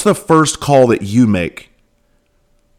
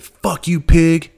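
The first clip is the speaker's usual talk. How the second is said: a loudmouth yelling from the corner; retold yell; 7 semitones higher, much slower than usual. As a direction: yell it across the street at the cop car. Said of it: "and he yelled out".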